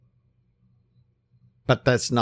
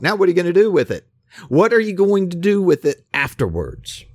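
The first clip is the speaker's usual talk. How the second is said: higher, louder, lively Jesus voice; voicing Jesus, animated; 8 semitones higher, 5 dB louder than usual. Preacher voicing Jesus challenging the healed man, higher and louder.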